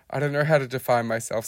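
plain reading voice

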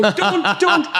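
high-pitched retold "stop him" plea; comic high yelp of protest; mock panicked cry. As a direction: cry out as if trying to stop someone, hammed up. Acting out trying to stop him.

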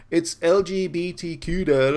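imitating a child setting her dad straight; correcting daughter. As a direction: correct Dad like a know-it-all kid. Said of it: "my daughter's like".

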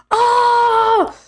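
high-pitched, drawn-out mock dismay; outraged fans. Fans on the message boards, mock outraged. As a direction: wail it high like a scandalized fan.

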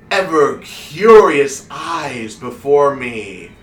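slow, loud, drawn-out stage proclamation; grand wizard showman. Acted. Wizard introduces his mystery box to the crowd, grandly proclaiming. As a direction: announce it slowly with big rolling swells.

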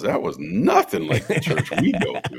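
lightly voiced protesting kid, pitched high; incredulous child. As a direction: object like a baffled little boy. Plays son objecting to church claim.

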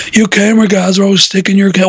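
lightly imitated wrestler voice; a hint of a complaining wrestler impression; Stone Cold. Recalling an old promo, mild wrestler impression. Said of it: "Stone Cold going".

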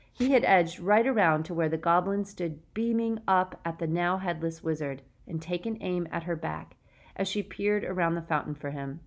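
ordinary delivery, nothing marked

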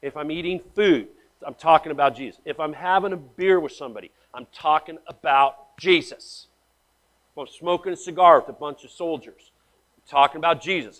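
ordinary delivery

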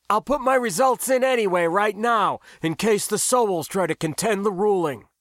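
high-pitched, eager rush of relief. A narrator's reading. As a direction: keep it up high, over the noise.

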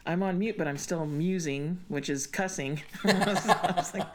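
ordinary talk

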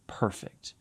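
soft breathy stress on "perfect"; hushed on perfect. Shown as a demonstration. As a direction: breathe "perfect" almost unvoiced. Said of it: "Let me read that again".

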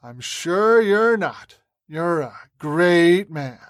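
slow, drawn-out mocking sneer. A narrator's reading. Terrorist leader taunting the captive senator, slow sneer. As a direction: stretch the words in fake admiration. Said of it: "Mick sneered".

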